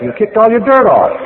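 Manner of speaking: high, loud punchline in a hammed-up wino voice; old wino punchline. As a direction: toss off the last line like a smug old drunk. Wino delivers the joke punchline.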